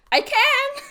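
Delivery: high, loud burst through laughter; laughing retort. Real, not acted. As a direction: crack up while blurting it out. Laughing comeback to a quote.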